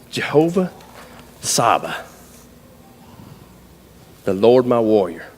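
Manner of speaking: low, weighty, deliberate pauses; solemn awe. Retold or imitated. Cowboy recalling a name of God, solemn. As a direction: intone it like a sudden revelation.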